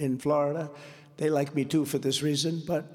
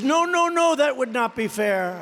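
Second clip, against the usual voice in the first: high, loud mock protest as Macron; 7 semitones higher, 5 dB louder than usual. Rally speaker acting Macron refusing the tariff, loud mock protest. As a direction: cry out in mock outrage, much higher.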